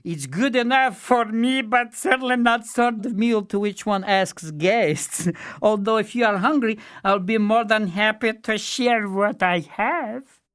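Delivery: high, shy fool voice; bashful fool. A narrator's reading. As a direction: apologise like a humble simpleton.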